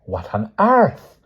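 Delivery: slow, loud, high exclamation; outraged old woman; angry scolding. A narrator's reading. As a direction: scold with indignant drawn-out words. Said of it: "she scolded angrily".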